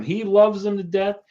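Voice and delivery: ordinary talk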